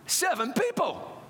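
high, drawn-out disbelief; incredulous. Retold or imitated. Newcomer counting the congregation, incredulous. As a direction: stretch it out, astonished at the tiny number. Dismay at the tiny turnout.

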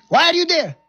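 high, loud demand; demanding officer. Retold or imitated. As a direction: bark it through the door. Lieutenant demanding at door.

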